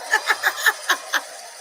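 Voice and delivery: breathless burst of laughter. Real, not acted. Woman laughing about her crying streak, breathless laughter. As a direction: crack up, airy and wheezing.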